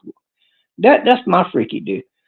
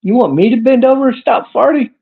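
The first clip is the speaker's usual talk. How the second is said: mock reply; 8 semitones higher than usual. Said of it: "then he answers himself".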